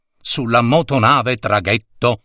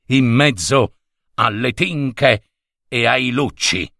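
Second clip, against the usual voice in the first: ordinary delivery, nothing marked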